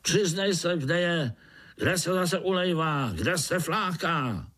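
high, pressing overseer voice; roaring interrogation. A narrator's reading. Ant overseer interrogates the spider about the shirker, roaring. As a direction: press for a confession, high and hectoring. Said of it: "zařval".

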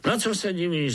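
high question with a grin; high-pitched cocky overseer; grinning overseer. Acted. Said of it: "zazubil se dozorce".

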